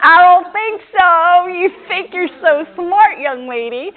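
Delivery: high, loud, slow mock-stern father teasing his daughter; hammed-up stern father, laughing through the scolding; father's scolding voice. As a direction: play the dad who knows better, drawn out and loud. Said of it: "I hear my father say that".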